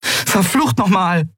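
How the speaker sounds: high, drawn-out curse; exasperated interruption. A narrator's reading. Pilot cutting into her crew's squabble, exasperated. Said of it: "funkte Endriel dazwischen".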